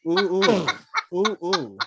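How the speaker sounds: hammed-up erotic audition snippet; playful fake screen-test delivery; mock audition. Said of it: "my audition for Fifty Shades of Grey".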